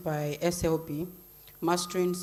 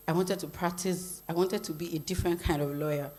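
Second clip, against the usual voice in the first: ordinary delivery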